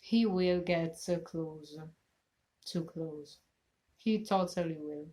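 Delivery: usual speaking voice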